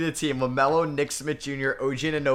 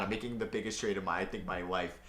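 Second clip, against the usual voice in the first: usual speaking voice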